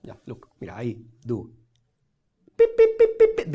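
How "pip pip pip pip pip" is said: squeaky high pips as the card rises; high, loud cartoon pips; playful high beeping to sell the magic; high pip sound effect.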